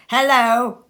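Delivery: loud, put-on gruff hello; mock growl. Scrooge pretending to scold late Bob, loud mock growl. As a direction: feign the old gruffness, loudly. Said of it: "growled Scrooge in his accustomed voice".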